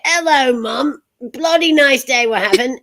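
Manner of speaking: cheeky English cousin in an imitated English accent; cousin's English accent. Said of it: "my cousin Patrick would come and he'd say".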